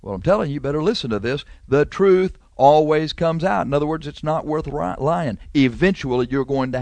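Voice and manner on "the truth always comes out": slower, louder sermon refrain; emphatic refrain. Acted. Preacher repeats his refrain to listeners, slow and emphatic. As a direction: hammer the refrain home.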